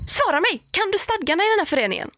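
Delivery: high, fast, demanding neighbour imitation; mock angry neighbour. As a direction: snap the question back at her like the furious neighbour.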